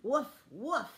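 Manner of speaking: playful barking for a picture book; dog bark. Pretend. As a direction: bark like a friendly sheepdog. Sheepdog page, dog sound.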